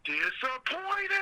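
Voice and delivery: high, drawn-out yell of one word; mock yell. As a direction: yell it out high and stretched, as a misread script line. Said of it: "he yells out".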